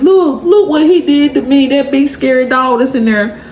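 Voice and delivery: put-on very high, babyish puppy voice; little puppy. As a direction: play a pitiful puppy telling on the big dog. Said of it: "acting like a little puppy".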